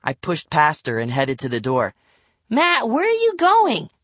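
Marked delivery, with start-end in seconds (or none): 2.5-3.9 put-on high child's call; high, urgent kid voice; little sister calling. A narrator's reading. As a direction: call after him in a small girl's voice. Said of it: "Livy called after me".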